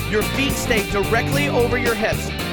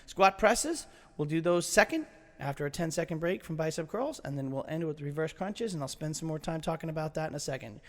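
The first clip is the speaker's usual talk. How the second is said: much softer, lower, relaxed; soft, calm instruction; 7 semitones lower, 11 dB quieter than usual. Real, not acted. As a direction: wind down gently.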